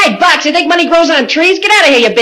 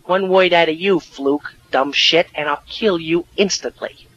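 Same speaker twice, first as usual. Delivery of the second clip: low, soft menacing aside; hushed threat; 10 semitones lower, 7 dB quieter than usual. Acted. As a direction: threaten so the officer cannot hear. Secret threat to Fluke.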